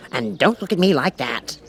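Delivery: lightly voiced huffy king; a touchy old king scolding. The King scolds the Cat, lightly voiced huffy king. King's voice.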